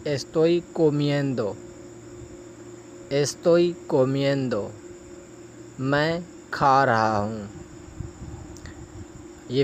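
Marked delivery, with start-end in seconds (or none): 0.1-4.7 slow, careful Spanish, each syllable clear; slow model phrase. Shown as a demonstration. Teacher modelling a Spanish phrase for students, slow and careful. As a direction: model it slowly for learners. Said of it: "پہلا فقرہ ہے ہمارے پاس".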